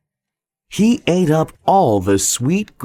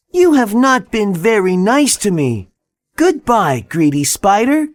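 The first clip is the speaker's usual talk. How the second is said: put-on higher boy voice, firm farewell; a young boy telling off the spider; scolding boy voice; 8 semitones higher than usual. Boy leaves the stuck spider, higher firm boy voice.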